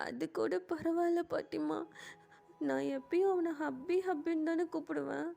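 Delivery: acted crying. Jimin confiding rejection to grandmother, tearful. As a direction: pour out the hurt through acted sobs. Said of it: "செம்மையா அழுதுட்டு இருக்காரு".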